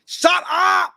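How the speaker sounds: high, loud bark; mock Schwarzenegger roar; shouted impression. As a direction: yell it like a furious cop. Quoting Schwarzenegger yelling.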